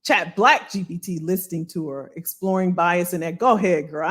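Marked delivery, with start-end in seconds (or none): none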